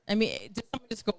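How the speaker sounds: usual speaking voice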